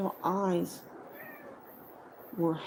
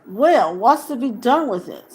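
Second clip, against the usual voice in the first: gruff; 5 semitones higher, 13 dB louder than usual. Acted. Sam gruffly asks Frodo.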